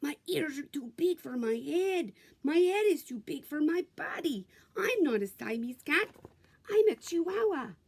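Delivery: put-on a light Spanish accent, rising; cat boasting in a light Spanish accent. A narrator's reading. Kitten announcing he is a Chihuahua, light Spanish accent. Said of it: "using his very best Spanish accent".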